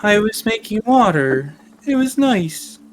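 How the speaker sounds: the horse mourning its lost trick; sad little horse. Acted.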